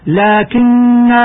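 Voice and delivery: لكن ending in a long drawn alif at the stop; pausal form. Shown as a demonstration. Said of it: "ويوقف عليها هكذا". (الوقف على لكنا بالألف)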